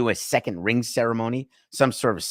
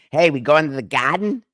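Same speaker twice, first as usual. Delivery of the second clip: raised, louder Boston local asking; Boston accent; 5 semitones higher, 4 dB louder than usual. Acted. Imagined Bostonian asking about the game, Boston accent. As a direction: drop the R in "Garden" like a Bostonian. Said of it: "But in Boston, it's".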